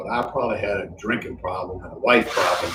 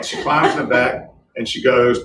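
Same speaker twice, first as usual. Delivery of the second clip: ordinary talk, nothing marked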